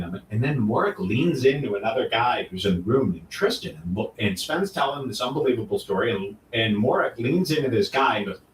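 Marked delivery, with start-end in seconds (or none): none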